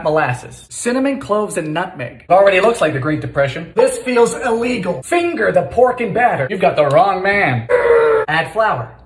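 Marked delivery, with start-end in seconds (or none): none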